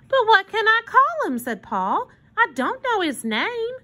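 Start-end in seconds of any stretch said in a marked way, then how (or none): 0.1-1.4 put-on high, louder little boy's question; puzzled child's protest. A narrator's reading. Paul objecting to his sister, high childish protest. 2.4-3.7 put-on high-pitched little boy; childish objection, pitched up; protesting child. A narrator's reading. Paul explaining to his sister, high childish protest.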